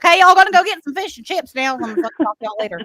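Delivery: loud, high-pitched hammed-up Southern accent; exaggerated Southern drawl. Panicking abroad, overdone loud Southern accent. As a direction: lay on a loud Southern twang. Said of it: "go full fucking, like, the opposite direction".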